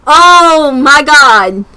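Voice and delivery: real exasperation; exasperated. Real, not acted. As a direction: drag it out in disbelief. Exasperated drawn out.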